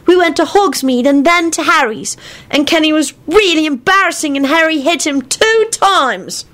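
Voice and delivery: put-on high-pitched little boy telling tales; tattling child. A narrator's reading.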